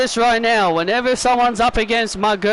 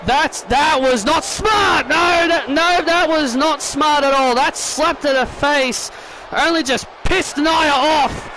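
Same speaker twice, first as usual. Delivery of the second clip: raised, higher-pitched play-by-play outburst; excited commentary; 5 semitones higher than usual. Pretend. Commentator scolds Henley as Nia wins, excited outburst. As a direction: call the finish with rising urgency.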